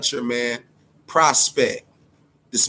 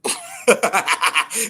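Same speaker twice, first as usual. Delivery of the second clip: short amused laugh, rising; a burst of laughter that lifts at the end; real laugh; 3 semitones higher than usual. Real, not acted. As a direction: laugh at your own joke. Laughs after his own joke.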